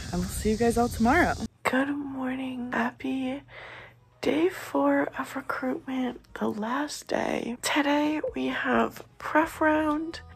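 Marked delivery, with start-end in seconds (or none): 1.6-10.2 hushed early-morning whisper. Real, not acted. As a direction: whisper so nobody wakes up. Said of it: "early morning quiet to not wake others".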